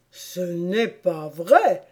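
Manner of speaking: very slow, drawn-out denial; heavy, emphatic contradiction; contradicting March Hare. Acted. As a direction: deny it word by word. March Hare interrupts to deny.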